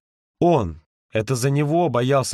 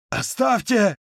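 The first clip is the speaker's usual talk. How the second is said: high barked command; 10 semitones higher, slower than usual. A narrator's reading. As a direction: bark it at him. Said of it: "рявкнул Ка". (рявкнул зло)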